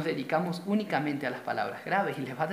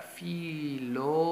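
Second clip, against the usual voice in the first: split into syllables; 3 dB quieter than usual. Shown as a demonstration. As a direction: hold each piece of "Filosofía", pausing between syllables. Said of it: "la voy a separar".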